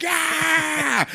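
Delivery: high shouted yeah; triumphant yell. Retold or imitated. Toby answering the troops with a high triumphant yell. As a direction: roar it like a rock star.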